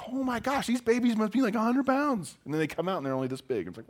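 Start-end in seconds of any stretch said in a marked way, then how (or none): 0.1-2.4 wife complaining. Retold or imitated. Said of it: "my wife was just like".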